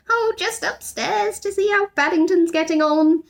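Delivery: put-on high innocent girl voice; high breezy girl's evasion; Judy covering. A narrator's reading. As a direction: sound casual, hiding trouble.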